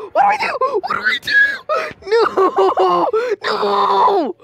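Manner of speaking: high panicked cries. Real, not acted. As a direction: panic as it gets you. Panicking as monster attacks.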